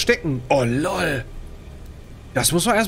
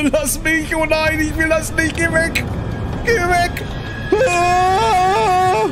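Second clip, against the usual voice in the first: panicked screaming; 12 semitones higher, much slower than usual. Real, not acted. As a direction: scream as the monster chases you. Monster right behind her. (panisches Kreischen)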